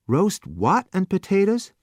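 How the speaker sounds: never heard the word before; puzzled youth asking the waiter. A narrator's reading.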